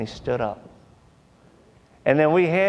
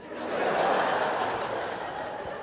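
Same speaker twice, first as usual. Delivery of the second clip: quiet airy chuckle; breathy laugh; 7 dB quieter than usual. Real, not acted. Audience laughter at joke.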